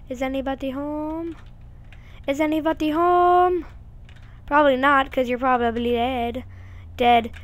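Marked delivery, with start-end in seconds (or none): 2.3-3.7 louder, higher call. Pretend. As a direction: call out into the house. Calling into empty house.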